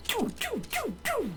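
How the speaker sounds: choo choo sound effect. Retold or imitated. Imitating dart trap firing.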